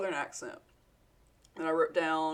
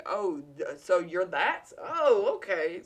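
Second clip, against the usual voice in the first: savage comeback; 5 dB louder, slower than usual. Retold or imitated. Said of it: "just be savage that way".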